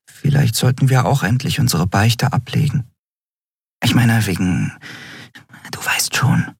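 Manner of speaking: a hint of embarrassed creature; embarrassed small creature. A narrator's reading. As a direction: sheepishly fade out. Nelen embarrassed.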